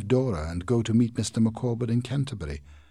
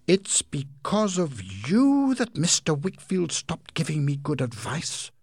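high, slow, louder furious accusation; angry outcry; 9 semitones higher, 5 dB louder, slower than usual. Acted. Aunt seizing Heep by the neck, high and furious. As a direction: blame him to his face. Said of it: "she cried".

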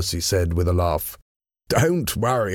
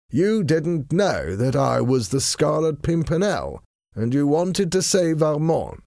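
reassuring husband, pitched higher; lightly voiced gentleman; 8 semitones higher than usual. A narrator's reading. Husband excusing his wife's mistake, lightly voiced reassurance. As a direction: lightly play an unruffled gentleman, pitch lifted.

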